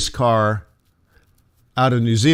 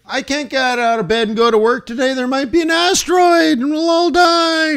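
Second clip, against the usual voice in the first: high, rising to a shouted panicky whine; mock panicky trader; 13 semitones higher than usual. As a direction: mimic a trader too scared to get out of bed. Mocking an overcautious trader.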